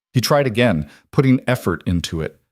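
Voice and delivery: ordinary delivery, nothing marked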